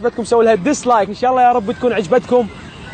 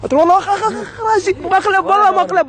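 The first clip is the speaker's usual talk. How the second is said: pained cry; 8 semitones higher than usual. Acted. Hit on the head in a prank.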